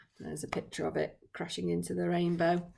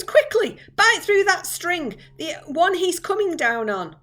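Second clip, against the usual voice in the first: high, loud urgent order; urgent yell; 9 semitones higher, 7 dB louder than usual. Acted. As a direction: hurry him along, yelling. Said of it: "yelled James".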